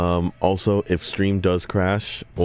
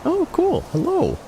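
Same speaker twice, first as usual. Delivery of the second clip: excited greeting; 13 semitones higher than usual. Real, not acted. Greeting a friend in chat.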